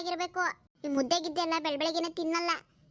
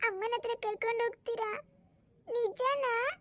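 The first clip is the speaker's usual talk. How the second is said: put-on high little-boy voice; hopeful child; 7 semitones higher than usual. Boy asks whether he'll see mother, hopeful. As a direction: ask with tearful, childlike hope.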